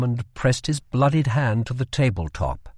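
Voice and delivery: ordinary delivery, nothing marked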